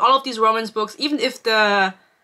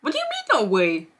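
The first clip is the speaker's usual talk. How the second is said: incredulous. Real, not acted. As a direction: jump way up in pitch, disbelieving. Incredulous reaction to book.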